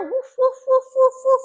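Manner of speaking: high, rapid string of woofs; playful dog woofing. Pretend. Host giving children a dog clue, woofing high. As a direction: woof like a dog, pitched well up. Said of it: "He's woofing out over here".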